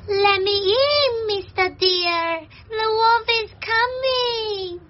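high, drawn-out plea for help; high-pitched frantic character calling to be let in; alarmed rabbit. Acted. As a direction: cry out slowly in a high rabbit voice. Rabbit fleeing from the wolf.

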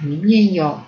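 ordinary delivery

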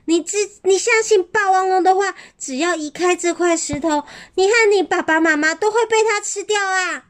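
dinosaurs shouting warning. A narrator's reading. Other dinosaurs warn.

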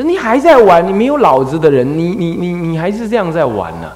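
loud, high, scolding a son at play; a mother snapping at her idle boy; shrill mother's rebuke, loud and high. Retold or imitated. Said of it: "他娘就骂他了".

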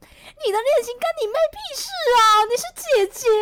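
loud, high indignant outburst; outraged. Retold or imitated. To her ex's sister excuse, a loud outraged retort. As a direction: blast it like an outraged ex.

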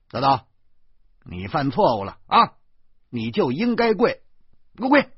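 loud scolding rising to a shout; relentless angry father; stern command. Acted. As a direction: order the child down, building in force. Orders child to kneel, unmoved.

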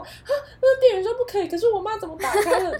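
put-on a kid torn between clerk and mom; shocked child. 小孩内心冲击. (小孩困惑惊讶的高声)

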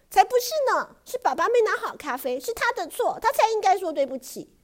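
put-on high, loud indignant child; a stubborn little girl's loud denial; indignant protest. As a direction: shout back that it isn't her fault. Child insists it's dad's fault.

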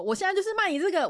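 high, fast mock sales pitch; mocking shout. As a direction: blurt it like a pushy seller. Mocking blatant fan service. (高亢快速的嘲讽叫卖)

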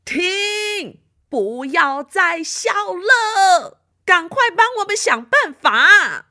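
high, loud group shout; shouting stop. Acted. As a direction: yell at her to stop. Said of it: "通通都大叫".

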